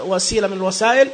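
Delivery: ordinary delivery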